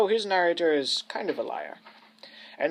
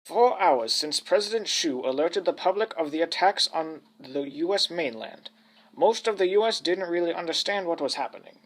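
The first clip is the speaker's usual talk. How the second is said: mock-grand British storyteller, sweeping intonation; old British narrator; 7 semitones higher, 9 dB louder than usual. British narrator explaining himself to the listeners, pompous old man's voice. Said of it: "the patois of an old British man".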